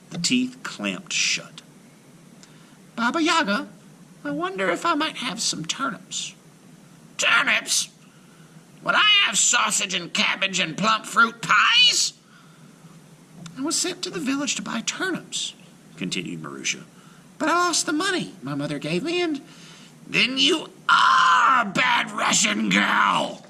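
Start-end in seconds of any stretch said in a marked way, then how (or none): 2.9-6.3 put-on high timid girl; shy child asking politely; little girl voice. A narrator's reading. Marusha voiced. 7.2-12.1 high mocking witch; scornful witch voice. A narrator's reading. Baba Yaga voiced. 13.6-19.4 put-on high timid girl; a shy child explaining; little girl voice. A narrator's reading. Marusha voiced. 20.1-23.4 high, slow shouting witch. A narrator's reading. Baba Yaga voiced.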